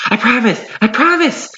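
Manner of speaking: high, desperate pleading; exhausted pleading. A narrator's reading. Beaten wolf begging Carlos, high exhausted plea. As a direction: beg for mercy, worn out. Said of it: "in an exhausted, pleading voice".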